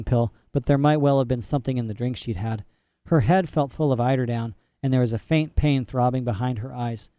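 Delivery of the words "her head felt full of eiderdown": ordinary delivery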